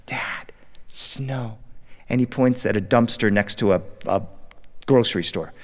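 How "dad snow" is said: unvoiced, hushed kid's whisper. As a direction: breathe it out like a tired toddler. Son whispers from back seat.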